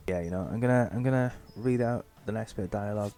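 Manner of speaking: ordinary delivery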